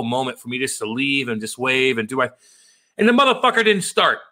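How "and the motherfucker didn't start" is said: high, loud frustrated burst; exasperated outburst. Real, not acted. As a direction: blurt it out in disbelief. Car failing to start.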